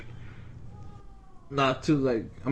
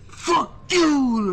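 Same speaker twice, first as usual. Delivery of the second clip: high mock yell; retold screamed insult; angry customer's shout; 13 semitones higher, 4 dB louder than usual. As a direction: burst out like an enraged customer. Acted quote of an angry customer.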